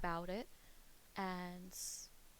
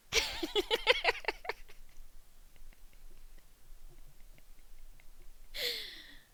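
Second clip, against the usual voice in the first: long high falling cackle; high cackling laugh. Real, not acted.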